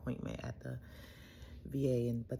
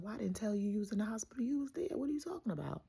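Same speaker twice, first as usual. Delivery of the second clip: much higher, hushed disbelief; incredulous echo; 8 semitones higher than usual. Retold or imitated. Daughter echoing her dad's question in high-pitched disbelief. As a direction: throw his question back in disbelief.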